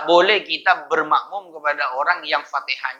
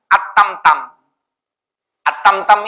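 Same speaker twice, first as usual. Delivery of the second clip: imitating a stutter; 5 semitones higher, 5 dB louder than usual. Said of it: "Kayak pelawak itu. Gagap".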